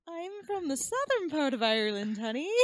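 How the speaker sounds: high-pitched, playful Irish lilt; put-on Irish accent. Retail worker caught switching accents, answering customer in mock Irish. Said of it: "two different accents with the same customer".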